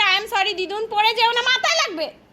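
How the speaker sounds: high mock child's voice, sweetly apologetic; mother mimicking her toddler's apology in a high childish voice; imitating the little girl. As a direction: parrot her daughter's cute excuse in a high voice. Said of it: "এখন বলছে".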